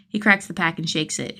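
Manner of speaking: usual speaking voice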